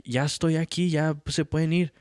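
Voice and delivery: high, mock-sassy answer; lightly voiced cheeky retort; defiant reply. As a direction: talk back without quite arguing. Son answering dad back.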